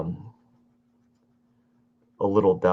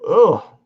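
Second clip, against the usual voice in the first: yawn; 11 semitones higher, 13 dB louder than usual. Real, not acted. Yawn breaking out.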